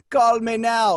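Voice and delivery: a light imitation of Miss Cleo's Jamaican ad voice; a hint of a Jamaican accent, punchy. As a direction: sell it like a Jamaican TV psychic. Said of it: "her accent would slip".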